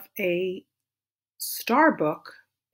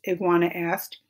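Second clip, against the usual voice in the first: usual speaking voice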